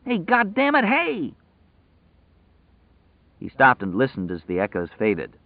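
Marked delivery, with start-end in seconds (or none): 0.1-1.3 high-pitched prisoner's holler; frustrated, high yell; yelling for attention. A narrator's reading. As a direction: call out angrily down the cell block.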